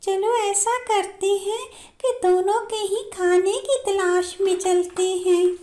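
very high, squeaky-small bird voice; बड़ी बहन चिड़िया. Acted. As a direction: play the big sister bird far up in pitch. (बहुत ऊँची आवाज़ वाली चिड़िया दीदी)

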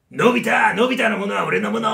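loud, bossy bully shout; pushy bully demanding his stuff; Gian yelling. Shown as a demonstration. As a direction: bark it at Nobita like a tyrant. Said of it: "っていうジャイアンが".

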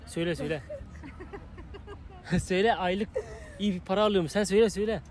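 ordinary talk

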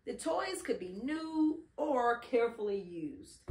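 plain reading voice

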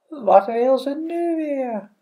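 high, slow fish voice; drawn-out high fish question; magic fish. Acted. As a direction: answer slowly in a high creature voice. The fish answers the caller.

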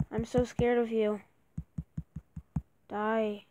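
ordinary talk

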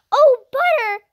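loud high delighted cry; cried, eyes shining. A narrator's reading. Girl given butter after many days, loud joyful cry. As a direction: burst out with joy at butter. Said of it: "cried Jessie".

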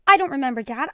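a sudden, loud, startled reply. A narrator's reading. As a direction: caught off guard and blurting it out.

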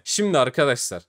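ordinary talk, nothing marked

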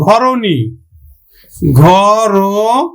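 ঘরনী stretched very slowly, র as রো; drawn out. Shown as a demonstration. Teacher stretching the word for students, very slowly. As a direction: draw out ঘরনী slowly with a rise. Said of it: "আমরা উচ্চারণ করব কিন্তু".

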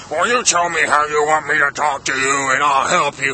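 put-on voice of a strange clerk; odd store clerk voice. Clerk offering to change how he talks, odd put-on voice. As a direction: do the weird shop assistant. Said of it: "why are you talking to me like that?".

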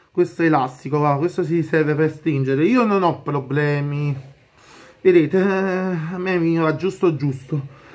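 speaking through fabric covering mouth; through a face mask. Real, not acted. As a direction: mouth covered by cloth balaclava. Seen 1: Skull-print balaclava covers his mouth and nose throughout the labelled words.

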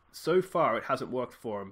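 ordinary delivery

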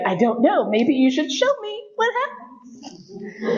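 high, slow, teasing coy voice; coy reply. Retold or imitated. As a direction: play innocent, draw it out sweetly. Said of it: "I was like".

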